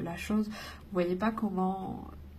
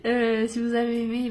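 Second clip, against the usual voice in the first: laughing, teary; 2 semitones higher, 3 dB louder than usual. Real, not acted. Laughing, emotional sign-off.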